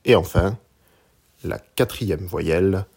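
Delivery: usual speaking voice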